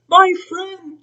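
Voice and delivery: very high, small and far-off; tiny Who voice. Acted. Mayor of Whoville calling up to Horton, tiny high voice. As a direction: speak up from somewhere tiny. Voice from the speck.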